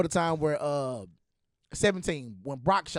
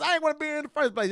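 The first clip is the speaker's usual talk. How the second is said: fast high complaint; 10 semitones higher, faster than usual. Retold or imitated. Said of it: "I said".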